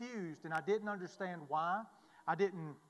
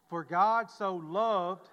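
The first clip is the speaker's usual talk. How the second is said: "loved" stretched out slow and louder; stress on loved; 6 dB louder, much slower than usual. Shown as a demonstration. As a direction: lean hard on "loved", drawn out. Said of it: "I want to really stress the word love".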